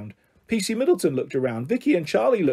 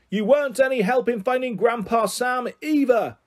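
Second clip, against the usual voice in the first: high-pitched yell, only moderately loud; loud boy's shout; 7 semitones higher than usual. A narrator's reading. Eleven-year-old accuses police over helicopter noise, shouting. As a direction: call it out over helicopter blades. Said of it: "as loud as his eleven-year-old voice could get".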